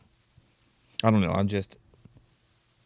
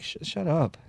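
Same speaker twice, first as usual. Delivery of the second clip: softer, higher mock retort; dismissive retort; 5 semitones higher, 6 dB quieter than usual. Fed-up listener to a rapper, dismissive retort. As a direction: cut the imagined rapper off, quietly exasperated. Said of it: "It's just like".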